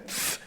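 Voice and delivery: the sound "th" said alone, soft. Shown as a demonstration. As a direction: softly sound out "th" on its own. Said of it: "that T H sound".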